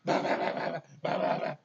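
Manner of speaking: loud, unvoiced bah bahs; loud playful bah. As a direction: puff out the bahs. Loud playful sounds to baby.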